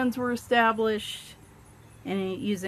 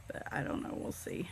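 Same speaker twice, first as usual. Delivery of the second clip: soft aside whisper; 6 semitones lower, 13 dB quieter than usual. Real, not acted. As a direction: breathe it to yourself. Trailing off to herself.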